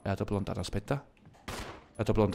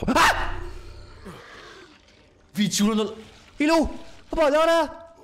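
jump-scared cry out; startled yelling; 12 semitones higher than usual. Real, not acted.

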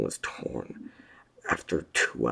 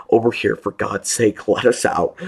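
desperate plea; 7 semitones higher, 11 dB louder than usual. A narrator's reading. Sobbing man begging to be freed.

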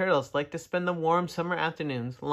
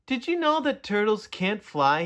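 calling down; 6 semitones higher than usual. Acted. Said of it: "he called down".

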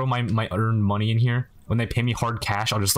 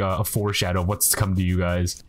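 usual speaking voice

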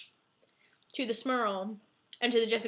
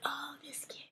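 whispered mock sigh of a priest; weary whisper; 13 dB quieter, slower than usual. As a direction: sigh it under your breath. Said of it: "Father Greg was like".

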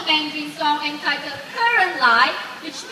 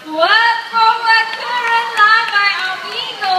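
showman announcement; 5 semitones higher, 7 dB louder than usual. Acted. Parrot finishes song.

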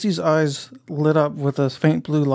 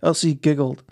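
usual speaking voice

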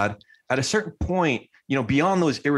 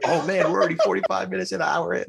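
higher, quick replay of realizing time is short; quick, high-pitched "oh man" to yourself; mock alarm; 7 semitones higher, faster than usual. Acts out own alarm at time.

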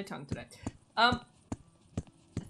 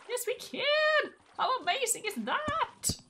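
thrilled; 12 semitones higher, 3 dB louder than usual. Real, not acted. Gamer telling viewers she can ride into the water, thrilled. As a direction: burst out with joy at the discovery.

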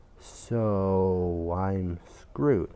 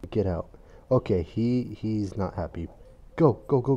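ordinary talk

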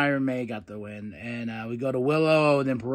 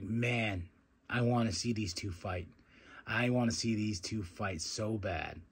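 hushed longing; 7 semitones lower, 11 dB quieter than usual. Retold or imitated. Retold longing reaction.

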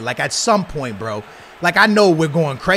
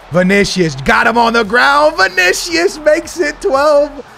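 high, loud drawn-out goal call; shouted commentator call; 9 semitones higher, 4 dB louder, slower than usual. Real, not acted. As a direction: stretch the name out slowly as he scores. Calling a goal.

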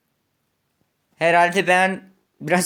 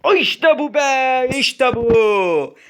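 high, loud victory shout; triumphant cheer; 8 semitones higher, 5 dB louder, much slower than usual. Real, not acted. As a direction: burst out as the jump finally lands. Clears the level after many fails.

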